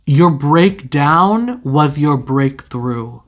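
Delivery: slow, drawn-out, weighty delivery; slow dramatic pronouncement. Real, not acted. Reader delivering the tarot message to viewers, slow and solemn. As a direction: intone it slowly like a prophecy. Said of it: "I don't know why I said it like that".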